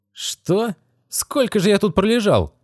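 put-on high, startled young boy; boy waking up in alarm. A narrator's reading.

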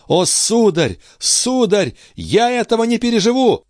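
high, impassioned lament; despairing brother. Acted. Brother tells count he cannot survive this, high despair. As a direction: build from high grief to a very high outcry.

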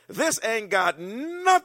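high, loud and slow, dismissive Jesus; loud bad English. Jesus brushing off his mother, loud and slow. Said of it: "that's how I would say".

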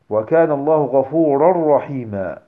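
"غفورا رحيما" as "ghafurar-rahima"; merged tanween. Shown as a demonstration. Teacher reciting verse example, tanween merged into raa. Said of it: "قل غفورا رحيما".

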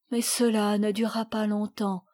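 usual speaking voice

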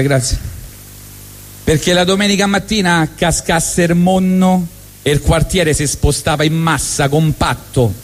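normal talking voice

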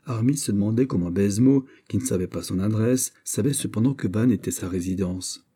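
usual speaking voice

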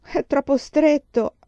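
high, loud, slow despairing wail; dismayed slow lament; desperate cry. Acted. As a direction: cry out that it won't fit. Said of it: "esclamò Mary disperata".

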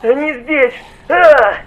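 shrieking high and loud; panicked scream. A narrator's reading. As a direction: scream in terror, wail at the end. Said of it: "завопил Диггер".

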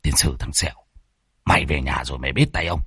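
low, soft grumbled threat; muttering. Acted. Exorcist privately threatening his teasing disciple, muttering. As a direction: grumble it under your breath. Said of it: "tái mặt lẩm bẩm".

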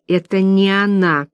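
usual speaking voice

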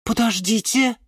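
high-pitched sudden realisation; sudden interjection. A narrator's reading. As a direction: cut in with a new idea. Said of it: "вдруг сказала орхидея Галатея". (внезапно, высоким голосом)

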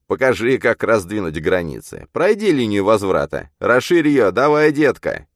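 taunting. A narrator's reading. Man mocks the girl's dream of pushing boundaries, taunting. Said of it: "Я издевался над ней".

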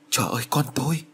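high, lightly voiced wail of dismay; anguished cry. Mother hearing her daughter's visions, a touch of anguish. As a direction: cry out for your child in mild despair.

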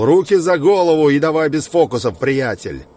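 arrest command, loud and high; shouting. A narrator's reading. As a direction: bark it like a cop. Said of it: "Такаши прокричал". (громкий окрик)

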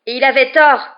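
high, very loud retort; angry cry. A narrator's reading. Boy contradicting his professor's claim of trust, crying out. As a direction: shout it in fury. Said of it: "s'écria Harry".

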